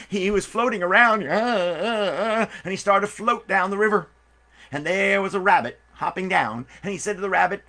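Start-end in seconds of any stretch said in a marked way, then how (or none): 1.2-2.5 struggling gasps. Acted. Ant struggling in water.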